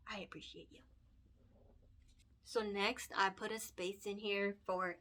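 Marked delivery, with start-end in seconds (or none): 0.1-0.8 soft breathy whisper after a sigh; emotional whisper. Real, not acted. Thanking her viewers, choked up in a whisper. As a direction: whisper it, barely holding back tears.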